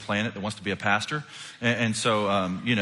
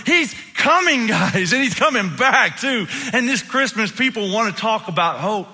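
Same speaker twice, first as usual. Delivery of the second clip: loud, high, impassioned proclamation; full-force preaching; 12 semitones higher, 7 dB louder, slower than usual. Real, not acted. Pastor urging church to share hope, impassioned preaching. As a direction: cry it out to the crowd, stretching key words.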